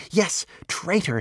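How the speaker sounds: fox's venomous agreement; hissed. A narrator's reading. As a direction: hiss it, drawing out the words. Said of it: "hissed the fox".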